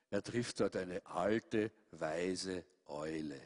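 tiefer, langsamer und leiser; geheimnisvoll leise. A narrator's reading. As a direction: die Eule feierlich einführen. Erzähler senkt Stimme für Eule. (Erzähler kündigt die weise Eule an, leise und langsam)

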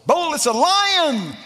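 slow, high, loud lion roar; a bold preacher's bellow; roared proclamation. Acted. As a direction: stretch it out like a roar. Preacher answers his own question.

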